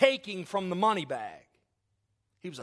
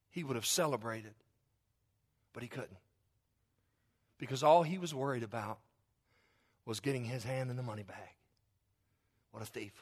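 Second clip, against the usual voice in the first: hushed preaching; 7 semitones lower, 5 dB quieter than usual. Acted. Preacher condemning Judas quietly, low and hushed. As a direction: lower and much softer, grave.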